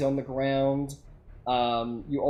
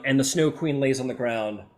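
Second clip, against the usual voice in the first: ordinary talk, nothing marked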